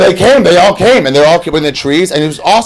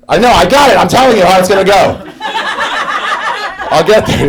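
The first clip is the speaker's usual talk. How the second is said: heading off objections; 10 semitones higher than usual. Real, not acted. Heading off the audience.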